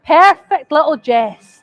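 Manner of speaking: loud, slow, mocking; slow taunting. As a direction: drag it out to taunt. Taunting Jess slowly.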